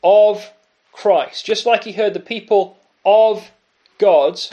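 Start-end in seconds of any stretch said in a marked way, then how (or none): 0.0-1.4 OF punched loud, pause, then Christ; loud, weighty OF, a beat, Christ; stress on OF. Shown as a demonstration. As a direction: isolate "of" with force before "Christ.". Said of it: "he's hearing these words".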